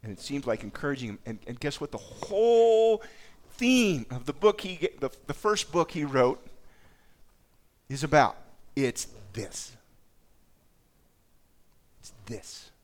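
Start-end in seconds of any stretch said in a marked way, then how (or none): 1.9-8.4 high, loud and slow, stretched out; rising dramatic emphasis; dramatic build. Acted. 12.0-12.7 hushed dramatic whisper; whispered repeat of the key line. Acted.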